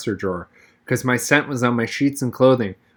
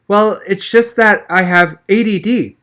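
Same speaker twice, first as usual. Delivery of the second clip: higher, louder, slow sheepish excuse; faked excuse; 8 semitones higher, 5 dB louder than usual. A narrator's reading. Boy fakes a disorder to his teacher, slow and sheepish. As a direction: stretch it out like an invented confession. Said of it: "That was pure blind inspiration".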